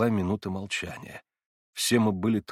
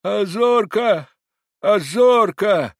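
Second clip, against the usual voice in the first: high, loud, slow plaintive call; mournful old man calling; 10 semitones higher, 8 dB louder, much slower than usual. A narrator's reading. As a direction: call the dog in longing. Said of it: "тоскливо повторял старик".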